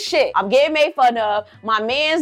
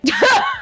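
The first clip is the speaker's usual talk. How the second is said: real laugh breaking out high; cracking up at herself; laughing at her own joke; high burst of laughter. Real, not acted.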